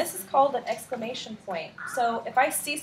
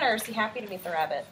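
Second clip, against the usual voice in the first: usual speaking voice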